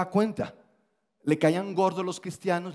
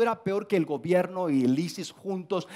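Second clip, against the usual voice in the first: ordinary talk, nothing marked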